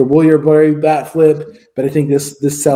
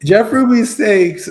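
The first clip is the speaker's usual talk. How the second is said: slow, higher announcer voice on "Jeff Ruby's Steaks"; mock ad read; 9 semitones higher, slower than usual. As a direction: pitch "Jeff Ruby's Steaks" like a cheesy commercial. Fishing for a sponsorship promo.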